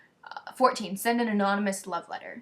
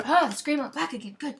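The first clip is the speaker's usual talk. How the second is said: dismayed surprise; 6 semitones higher, 4 dB louder than usual. Real, not acted.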